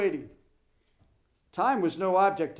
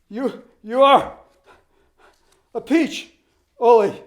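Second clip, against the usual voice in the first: gasped; 6 semitones higher, 12 dB louder than usual. Acted. Spent coach begging his runner, gasping. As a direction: gasp out the compliment. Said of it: "gasped Bost".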